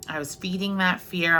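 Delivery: ordinary talk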